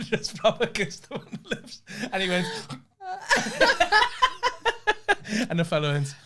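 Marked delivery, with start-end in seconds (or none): 3.0-5.4 long high helpless laugh; shrieking laughter. Real, not acted. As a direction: lose it completely laughing. Laughing at the kiss reaction.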